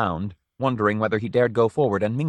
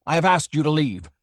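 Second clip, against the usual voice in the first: higher, firm repeat; stern insistence; 6 semitones higher than usual. A narrator's reading. Owner insists the old man leave, stern. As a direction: lose patience politely.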